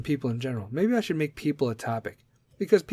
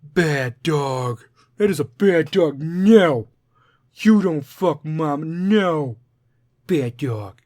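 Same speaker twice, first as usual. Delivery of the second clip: louder stern dog-scolding voice, slow on the middle line; 7 dB louder, much slower than usual. Acted. Man in joke scolds his best friend like a dog, loud. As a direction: scold him like a naughty dog. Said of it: "I looked him straight in the eyes and I said".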